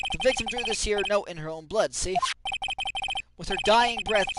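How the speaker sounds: ordinary delivery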